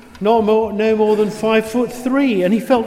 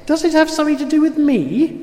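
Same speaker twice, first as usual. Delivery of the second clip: higher, quick anxious man; nervous man; 8 semitones higher, faster than usual. A narrator's reading. Katagiri character voice.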